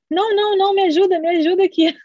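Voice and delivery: high, loud, panicky begging imitation; urgent high-pitched plea, played up; mock desperate pleading. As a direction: act out begging mum to stay. Retells begging mother for help.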